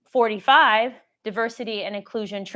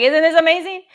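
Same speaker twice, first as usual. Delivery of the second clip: higher and louder, thrilled with herself; excited boast; 7 semitones higher, 8 dB louder than usual. Retold or imitated. Proudly telling her mentor how amazing it is, excited and much louder. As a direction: gush about how amazing it all is.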